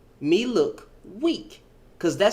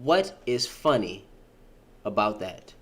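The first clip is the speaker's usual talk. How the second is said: muttered; 12 semitones lower, 6 dB quieter than usual. Real, not acted. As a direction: ask it again under your breath. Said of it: "I don't understand".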